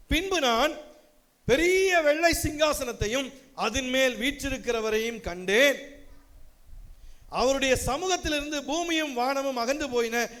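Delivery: solemn, lifted reading voice; preacher reading Revelation aloud to the congregation, solemnly; scripture reading. Acted. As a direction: proclaim the vision from Revelation. Reads scripture.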